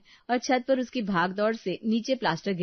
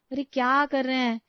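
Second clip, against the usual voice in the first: higher-pitched mild complaint; annoyed wife protesting; 6 semitones higher, 3 dB louder than usual. Acted. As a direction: object as if half-dreaming. Sleepy wife protests water being splashed.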